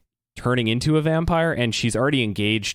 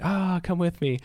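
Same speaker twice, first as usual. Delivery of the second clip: seductive vampire; 7 semitones higher than usual. Retold or imitated.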